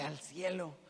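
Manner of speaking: put-on soft, childlike reply; little girl's answer. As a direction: say it like a small girl sure of herself. Father voices daughter's answer. (padre imitando a su niña, vocecita infantil)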